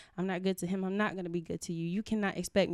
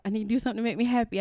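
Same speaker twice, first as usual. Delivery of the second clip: fast self-talk; 3 semitones higher, 4 dB louder, much faster than usual. Retold or imitated. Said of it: "repeats it to herself".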